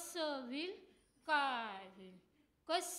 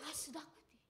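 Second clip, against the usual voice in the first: whispered aside; 10 dB quieter than usual. Acted. Secret aside about sister-in-law.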